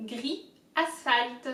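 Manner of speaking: gris, asphalt said slowly and carefully; slow demo. Shown as a demonstration. Teacher modelling the words slowly for viewers. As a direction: spell out gris, asphalt for learners. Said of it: "So all together".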